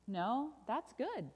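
slow, lilting contradiction; reassuring correction. Acted. Storyteller overturns the bad news, slow and lilting. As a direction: draw it out to reveal good news.

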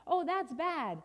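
high, loud dismay; alarmed refrain. Acted. Storyteller reacts to chasing baboons, loud alarm. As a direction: exclaim at the trouble.

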